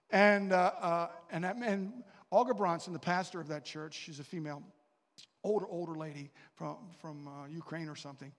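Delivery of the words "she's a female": ordinary talk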